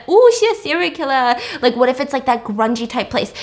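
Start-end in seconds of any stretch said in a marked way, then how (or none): 0.1-1.4 mock excited. Voices excited imagined viewer reaction.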